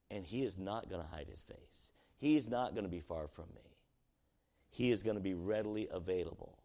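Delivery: normal talking voice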